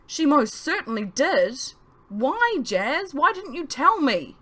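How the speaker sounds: high, loud hurt accusation; loud, high angry pleading. A narrator's reading. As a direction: demand answers, voice climbing. Charlie furious at narrator.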